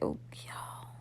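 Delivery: breathy awed whisper. Real, not acted. Cook admiring her buttered toast in a whisper. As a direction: hush it like a delicious secret.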